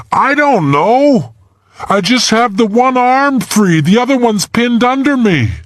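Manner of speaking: put-on boy pinned in a crevasse, calling up to Sarah, scared; trapped boy. A narrator's reading. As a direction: voice a scared boy stuck in ice. Trapped boy voiced in story.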